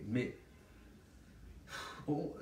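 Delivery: usual speaking voice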